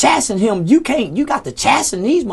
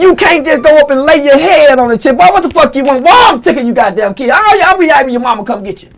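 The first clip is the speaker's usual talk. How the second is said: put-on louder, higher annoyed scolding of a kid; mock scolding; 5 semitones higher, 8 dB louder than usual. An adult yelling at a clingy kid, fed up and louder.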